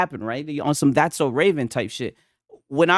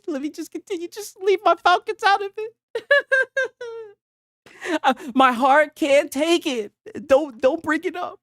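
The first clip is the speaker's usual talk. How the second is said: high, whiny fake-wounded plea; mock heartbroken; 13 semitones higher than usual. Streamer fakes heartbreak to chat over Falcons. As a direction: whimper it like a sore fan, laughing. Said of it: "I'm just joking".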